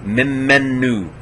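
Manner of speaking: Mimenu drawn out slowly, holding the m and nu; held consonants. Shown as a demonstration. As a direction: linger on the m and the nu in Mimenu. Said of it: "We have to hold the, the mem and the nu".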